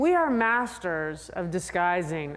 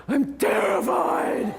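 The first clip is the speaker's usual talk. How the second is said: terrified rider; 4 dB louder, slower than usual. Retold or imitated.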